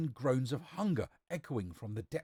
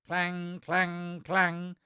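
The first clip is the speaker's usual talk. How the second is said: high, ringing monotone clangs; clanging pot; 7 semitones higher, 5 dB louder, much slower than usual. A narrator's reading. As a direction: imitate a metal pot clanging, pitched up. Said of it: "went the brass-footed pot".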